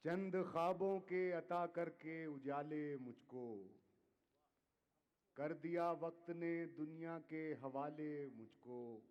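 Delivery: measured, declaiming poetry to audience; ghazal recitation. Acted. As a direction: recite each couplet with weight. Poet repeats couplet.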